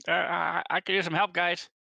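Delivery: high, loud plea for help; calling for help. Acted. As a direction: call out to the party, urgent. Character calls for help.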